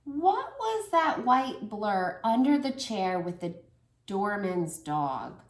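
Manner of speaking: usual speaking voice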